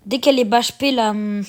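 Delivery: ordinary talk, nothing marked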